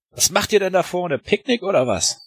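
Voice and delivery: shouted taunt across the trench; calling out. Acted. Soldier calls out across trench.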